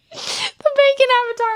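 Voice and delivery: tickled squeal. Real, not acted. Cracking up at the bacon avatar.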